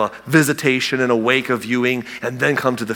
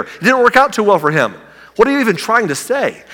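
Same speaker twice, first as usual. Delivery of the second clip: higher, pleading, exasperated questions; exasperated disciples; 7 semitones higher than usual. Retold or imitated. Disciples protest to Jesus about Lazarus, higher exasperated pleading. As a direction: complain like a baffled friend.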